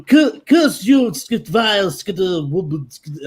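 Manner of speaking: put-on loud, slow, posh baby villain; Stewie Griffin voice. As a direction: sneer it like a cartoon villain baby. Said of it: "Popeye isn't that far removed from fucking Stewie".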